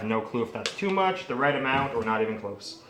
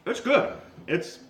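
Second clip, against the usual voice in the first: excited; 11 semitones higher, slower than usual. Real, not acted. Tasting the cornbread waffle.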